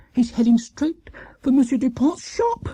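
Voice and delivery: high, breathless whisper of alarm; alarmed Missus Brown. A narrator's reading. Missus Brown fearing Paddington will hit the shop, alarmed. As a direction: gasp it out in fright. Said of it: "said Missus Brown".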